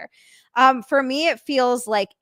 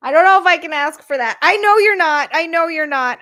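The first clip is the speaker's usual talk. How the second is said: higher, louder, fast, overwhelmed; emphatic protest; 8 semitones higher, 10 dB louder than usual. Real, not acted. Streamer to Frank, protesting loudly that she can't accept. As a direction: blurt it out, flustered by the kindness. Hesitating over Frank's offer.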